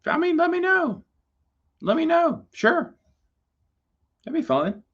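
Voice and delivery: light, high falsetto offer; playful high voice. Pretend. Offering to do one, playful falsetto. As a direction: pitch it way up, coyly casual.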